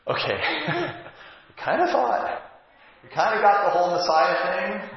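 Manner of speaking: sheepish awe, laughing at themselves; laughing disciples. Acted. Disciples in the boat admitting their awe, high and laughing. Disciples voiced after the storm.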